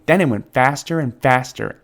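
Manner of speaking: usual speaking voice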